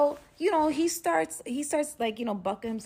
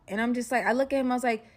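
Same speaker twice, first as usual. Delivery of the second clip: ordinary talk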